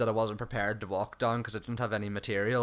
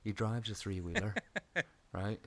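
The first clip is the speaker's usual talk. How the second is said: hushed; 4 semitones lower, 8 dB quieter than usual. Real, not acted. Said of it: "he could be listening in through the wall".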